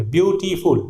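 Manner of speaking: "ब्यूटीफुल" split into byoo, tee, fool; three-syllable beautiful. Shown as a demonstration. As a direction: count out three beats in "ब्यूटीफुल". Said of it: "तीन syllable हो जा रहा है". ("ब्यूटीफुल" तीन हिस्सों में, ब्यू-टी-फुल)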